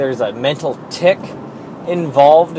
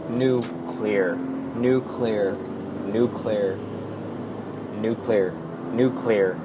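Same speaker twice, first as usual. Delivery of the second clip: "nuclear" broken after "new", then "clear"; pause after new; 4 semitones lower, 9 dB quieter, slower than usual. Shown as a demonstration. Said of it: "can't say the word correctly without pausing after new".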